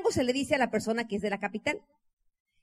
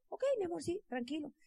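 meek, soft yes; 5 semitones higher, 10 dB quieter than usual. Retold or imitated. Wife answering husband meekly.

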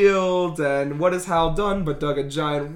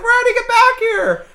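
much higher, louder call after a runaway; worried parent; 6 dB louder than usual. Retold or imitated. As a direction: shout after him in a panic. Mother calling out to son.

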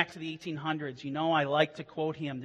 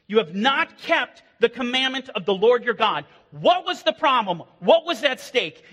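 rising, louder sermon build; fiery preaching; 6 semitones higher, 8 dB louder than usual. Acted. Pastor warning church about false shepherds, fiery preaching. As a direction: preach it hard to the pews.

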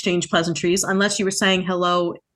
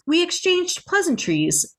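high, slow, fancy delivery; proper phrasing; 10 semitones higher, slower than usual. Shown as a demonstration. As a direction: say it like a refined speaker. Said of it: "what we're going to say is".

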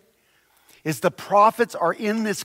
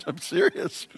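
high, softer, giggling; laughing; 10 semitones higher, 5 dB quieter than usual. Real, not acted. Preacher laughing at his California joke, half giggling. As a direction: insist through a laugh.

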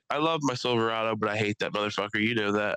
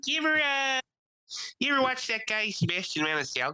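Italian fan impression; 13 semitones higher than usual.

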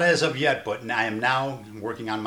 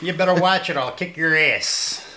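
Chicago tough guy; 7 semitones higher, 7 dB louder than usual. Retold or imitated.

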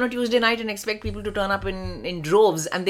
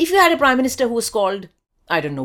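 ordinary talk

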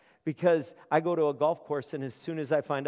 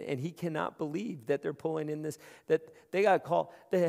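usual speaking voice

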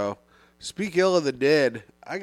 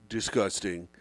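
disgusted; 8 semitones lower, 7 dB quieter than usual. Acted. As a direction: sink the word low in disgust. Ranting about conjoined twins.

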